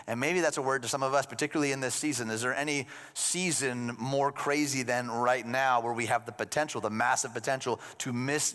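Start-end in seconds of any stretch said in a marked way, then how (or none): none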